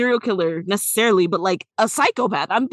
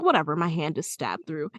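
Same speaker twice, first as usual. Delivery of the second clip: nonchalant; 6 semitones lower, 6 dB quieter than usual. Retold or imitated. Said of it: "And he's like".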